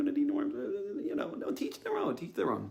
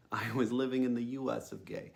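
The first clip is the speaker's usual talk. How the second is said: usual speaking voice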